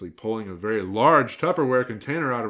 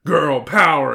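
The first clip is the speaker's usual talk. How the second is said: loud, punchy cheer; exclaimed rallying cry; 5 semitones higher, 12 dB louder, slower than usual. A narrator's reading. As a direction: belt it out, pitch a little raised.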